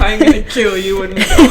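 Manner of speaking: mock threat.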